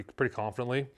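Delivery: usual speaking voice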